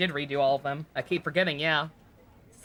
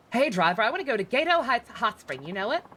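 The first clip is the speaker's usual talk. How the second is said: Kansai lady passenger; 6 semitones higher than usual. Acted. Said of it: "Sounds like she's from Kansai".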